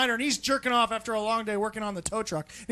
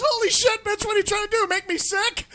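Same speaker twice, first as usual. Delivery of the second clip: mock outraged yell; 10 semitones higher, 5 dB louder than usual. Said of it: "he says".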